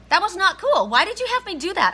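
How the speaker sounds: high, loud reenacted outburst; indignant mock yell of protest; retold angry yelling. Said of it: "I'm yelling at Spirit".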